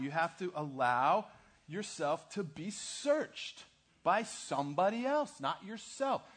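rising, slow pulpit insistence; preaching emphasis. Real, not acted. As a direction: pound the point to the nation. Preaching the vision's points.

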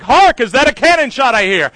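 loud, ringing theatrical delivery of the line; rehearsing a stage line. Retold or imitated. Actor rehearsing in the shower, loudly proclaiming. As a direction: belt it like a musketeer captain. Rehearsing while hailing cab.

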